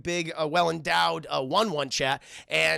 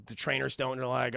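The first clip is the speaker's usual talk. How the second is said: ordinary talk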